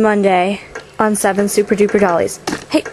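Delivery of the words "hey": high, bossy shoo-away. Real, not acted. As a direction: push the camera off, bossy. Shooing the camera away.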